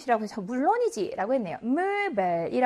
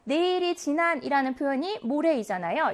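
ordinary talk, nothing marked

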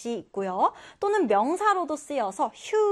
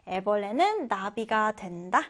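ordinary talk

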